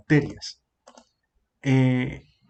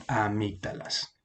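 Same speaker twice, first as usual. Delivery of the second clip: "amígdalas" slow, soft and low, stressed a-MÍG-da-las; correct stress on MIG; 5 semitones lower, 8 dB quieter, slower than usual. Shown as a demonstration. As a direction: model "amígdalas" slowly and softly, stress on MIG. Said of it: "el acento va aquí". («amígdalas» lento y suave, marcando MIG: aMÍGdalas)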